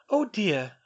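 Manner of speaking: a hint of brisk schoolgirl; young witch. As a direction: Hermione inviting the elf, lightly voiced girl. Hermione sees crying elf.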